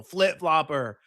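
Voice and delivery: slow, grand royal announcement; royal title proclamation. Acted. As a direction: proclaim it like a herald. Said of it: "that just sounded like a royal title".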